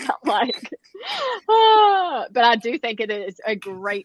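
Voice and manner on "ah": high, loud drawn-out "ah"; comic cry of dismay; mock wail. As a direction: let out an exaggerated, falling wail. Mimics her reaction to explaining.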